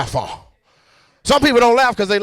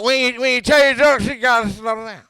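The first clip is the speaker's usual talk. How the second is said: put-on drunk dude. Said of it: "Drunk dude was there".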